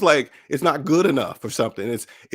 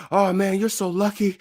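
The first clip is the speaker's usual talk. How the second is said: envious coworker; 6 semitones higher, 3 dB quieter than usual. Retold or imitated. Coworker envying a vacation.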